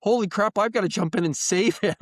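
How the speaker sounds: high retold panic through amusement; laughing alarm. Real, not acted. Host retelling his urge to save a friend, amused alarm. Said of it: "I should not be laughing this much".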